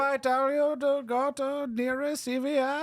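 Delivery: mock scammer voice. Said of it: "mocking scammer voice".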